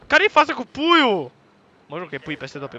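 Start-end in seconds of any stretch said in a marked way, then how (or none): 0.1-1.3 high, loud, slow question; incredulous shout. Real, not acted. Player baffled by a chicken in the game, high shout. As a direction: holler the question, stretched out. Exclaims at odd game detail.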